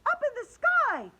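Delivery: high, loud excited yell; girl alerting classmates, loud high yell. Acted. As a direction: shout it, pointing at the sky. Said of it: "yelled Andrea".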